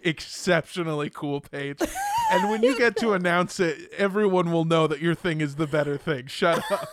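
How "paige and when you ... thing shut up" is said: ordinary talk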